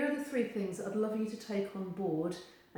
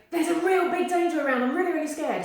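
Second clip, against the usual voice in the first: high, loud mock panic; mock scared brain; 7 semitones higher, 9 dB louder, faster than usual. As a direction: act out a frightened brain, overdone. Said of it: "their brain is going".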